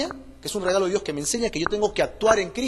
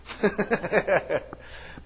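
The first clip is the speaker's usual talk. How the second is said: soft chuckle; 3 dB quieter than usual. Real, not acted. Laughs at own question.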